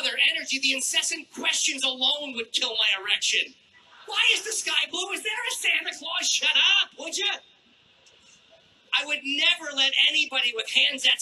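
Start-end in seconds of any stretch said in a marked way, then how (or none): 4.1-6.3 high-pitched mock kid questions; pestering child. Mimicking children's incessant questions. 6.3-7.4 high, irritated mock outburst; exasperated snap. Snapping back at imagined child.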